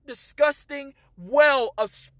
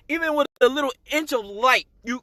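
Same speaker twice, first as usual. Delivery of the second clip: ordinary talk, nothing marked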